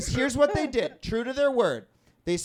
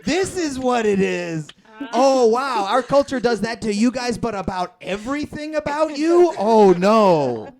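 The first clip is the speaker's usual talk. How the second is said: mock realization; 6 dB louder, slower than usual. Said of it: "It's like".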